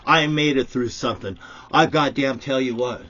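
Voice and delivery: angry shouting. Real, not acted. Furious rant at insurers.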